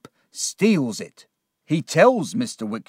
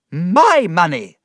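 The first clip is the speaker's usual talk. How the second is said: loud, slow outraged cry; shout; 5 semitones higher, 8 dB louder, slower than usual. A narrator's reading. Aunt discovering Heap stole her money, loud outraged cry. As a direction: erupt in outrage over the theft. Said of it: "my aunt shouted".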